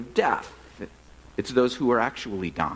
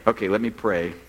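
usual speaking voice

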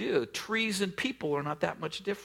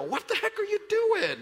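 half-laughing mock yell, much higher and louder; imagined road rage yell; 4 dB louder than usual. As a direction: blow up at the driver, but laughing at yourself. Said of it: "pull her out, and go".

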